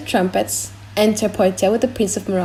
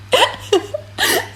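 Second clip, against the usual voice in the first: high burst of helpless laughter; high-pitched fit of giggles. Real, not acted. Reader cracks up.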